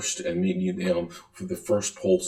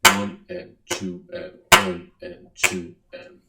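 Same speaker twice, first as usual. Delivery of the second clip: one stressed strongly, two light; slow duple count with strong beats; accented count; 11 semitones higher, 3 dB louder, slower than usual. Shown as a demonstration. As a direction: count with a strong-weak pulse. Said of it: "a strong beat and a weak beat".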